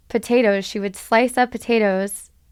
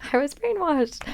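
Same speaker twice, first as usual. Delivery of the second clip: rueful repeat; 5 semitones higher, 4 dB quieter than usual. Real, not acted. Repeats line, higher and softer.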